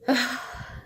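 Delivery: real heavy sigh; stressed sigh. Real, not acted. Recalling the stressful clinic visit, stressed sigh. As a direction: let out a long, stressed breath. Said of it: "So stressful for me".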